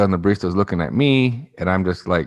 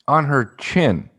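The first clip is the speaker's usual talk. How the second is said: ordinary talk, nothing marked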